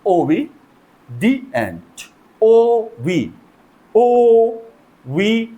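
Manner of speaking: Obedient slowly in separate chunks, then ओ, वी; "ओ" and "वी" each said separately and held; divided into syllables. Shown as a demonstration. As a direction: sound out "obedient" with pauses between pieces. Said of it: "three syllable में divide करते हैं".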